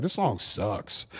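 slow, low, drawn-out verdict; imitated blunt critic. Daughter judging dad's song, imitated blunt verdict. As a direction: imitate a kid's put-down, slow and dropped in pitch. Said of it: "that same kid will be like".

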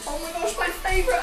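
ordinary delivery

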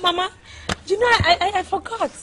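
loud, high, stammering excuse; flustered excuse. Acted. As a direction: blurt it out, caught at fault. Wife flustered before mother-in-law.

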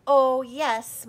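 loud, insistent Oh yes; firm mother. A narrator's reading. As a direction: insist hard, no arguing. Mother forcing mouth open.

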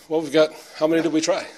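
chuckling through the answer; laughing. Real, not acted. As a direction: laugh while you say it. Joking about many two-point plays.

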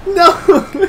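a no that breaks into laughter and a sob; half laugh, half cry. Real, not acted. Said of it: "This may be a lot of screaming".

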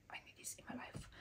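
barely audible whisper to herself; very soft, whispered inner reaction; awed whisper. Retold or imitated. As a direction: whisper it like a secret craving. Quotes her hushed reaction to scent.

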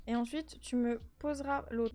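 normal talking voice

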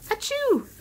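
big high pretend achoo; a playful fake sneeze; hammed-up sneeze. Teacher fakes a sneeze to mess up the letters.

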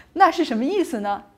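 ordinary talk

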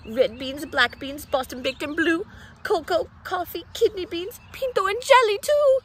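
building excitement. Acted. Dog lists beans rising excitedly.

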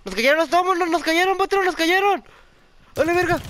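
very high, loud alarm cry; panicked shout. Real, not acted. Gamer yelling to his boss that they were ambushed, high panic.

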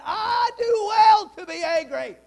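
loud, high furious outburst; Jonah shouting angrily. Acted. Jonah yelling back at God, furious. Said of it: "Can you hear him?".

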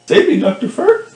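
shrill cry for help; high pleading shout. Pretend. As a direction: squeal it out, begging.

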